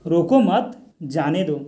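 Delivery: "रोको मत", short pause, then "जाने दो"; slow "रोको मत", separate "जाने दो"; comma pause after मत. Shown as a demonstration. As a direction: say "रोको मत" as one group, then break before "जाने दो". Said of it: "ये सब कोमा के चलते".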